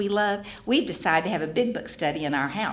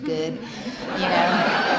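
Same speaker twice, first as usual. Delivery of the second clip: laughing; much slower than usual. Real, not acted. Laughing at Kodak moment.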